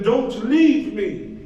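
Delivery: slow, drawn-out pleading; desperate plea. Acted. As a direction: stretch it out, begging. Trapped man begging.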